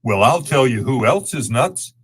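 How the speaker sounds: ordinary delivery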